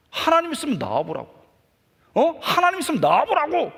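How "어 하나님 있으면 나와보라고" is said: higher and louder, 하나님 flung out in a yell; defiant yell. Retold or imitated. Angry teen boy yelling his dare at God. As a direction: shout the dare at the sky. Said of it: "막 소리를 속에서 지르면서".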